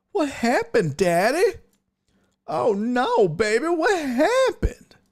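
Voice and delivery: put-on high, babying coo of pity; mock concern. Host asking a fired friend what happened, mock concern. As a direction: tease with exaggerated high-pitched sympathy.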